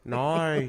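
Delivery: "Nar": Australian "no", vowel bent toward "noy". Shown as a demonstration. Correcting a near miss, "Nar" with an Australian vowel. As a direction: model "Nar" as an exaggerated Aussie "no". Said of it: "you're close".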